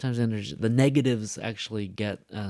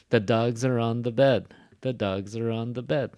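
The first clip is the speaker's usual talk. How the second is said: "dogs" and "bed" strong, "are on the" light; stress on dogs and bed; 5 dB louder than usual. Shown as a demonstration. Teacher modeling sentence rhythm, strong "dogs" and "bed". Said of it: "gets the stress".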